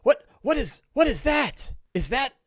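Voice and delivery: a busy official shooing away adventurers; flustered mayor. Acted. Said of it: "He goes".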